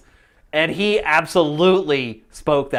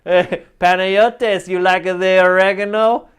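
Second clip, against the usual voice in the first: slow, higher hammed-up Italian accent; imitating boss's Italian accent; 3 semitones higher, 6 dB louder, slower than usual. Imitating the Greek pizza boss in a hammed-up Italian accent. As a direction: play the old pizza boss, drawn out. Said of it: "Or he'd say".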